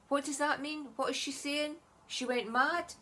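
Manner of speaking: questioning, mock-incredulous impression of viewers; mock bafflement. Voices baffled viewers' comments.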